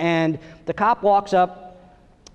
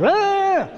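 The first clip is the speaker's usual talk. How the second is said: happy squeal; 11 semitones higher than usual. Retold or imitated. Toddler squealing.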